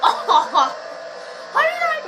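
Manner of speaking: laughing, voice breaking upward; laughing disbelief as the voice breaks; voice cracking. Real, not acted. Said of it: "my voice just cracked".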